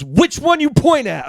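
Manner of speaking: slow, high-pitched mimicry of an impatient server; brusque shop woman. Donut shop woman questioning a pointing customer, brusque. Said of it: "it was like a soup Nazi situation".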